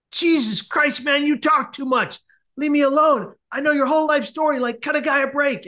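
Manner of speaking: high-pitched, exasperated rant; freaking-out outburst. Retold or imitated. Silent patient finally erupts at the chatty kid, freaking out. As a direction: erupt at someone who will not stop talking. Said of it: "And he's freaking out".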